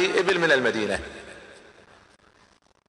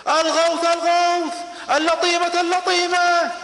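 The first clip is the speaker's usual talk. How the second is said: desperate scream; 12 semitones higher, slower than usual. Acted. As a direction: scream it out like a man raising the alarm. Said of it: "بدأ يصرخ". (صراخ استغاثة عالٍ)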